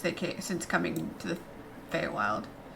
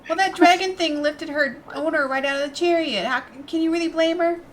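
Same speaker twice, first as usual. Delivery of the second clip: excited; 7 semitones higher, 9 dB louder than usual. Acted. Excited pleading for the creature.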